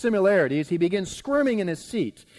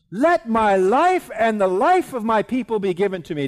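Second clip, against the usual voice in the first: loud, raised-pitch appeal; impassioned plea; 6 semitones higher, 8 dB louder than usual. Acted. Queen begging the king for her people's lives, loud and impassioned. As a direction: plead it out forcefully.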